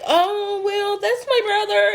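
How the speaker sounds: high mocking imitation of her, near crying; mocking high whiny voice. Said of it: "She like".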